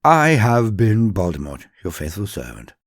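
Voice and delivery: plain reading voice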